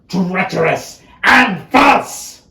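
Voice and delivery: building to a high, loud cry; relished villainy. Acted. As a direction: burst out on each word, rising in force. Villain revels in his treachery.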